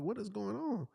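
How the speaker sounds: high-pitched acted bewilderment; retold alarm. Husband reacting to wife's sudden cries, mock alarm.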